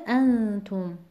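انتم stressed on its first syllable ا, said slowly; slow demo with stress on ا. Shown as a demonstration. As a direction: hit the opening ا of انتم. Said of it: "وزن بر حرف ا میاد".

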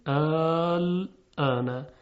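آل آنه with long stretched آ; drawn-out Quranic reading. Shown as a demonstration. Teacher models the word آل آنه for students, stretched. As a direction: chant آل آنه slowly with a long madd. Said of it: "حرف مدی داریم که الف هست".

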